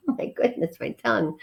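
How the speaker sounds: usual speaking voice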